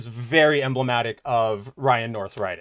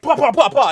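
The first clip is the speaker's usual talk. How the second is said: quick, high, louder pah-pahs of blows landing; mock fight noises; 9 semitones higher, 4 dB louder than usual. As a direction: fire them off like rapid cartoon punches. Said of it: "And he'd be like".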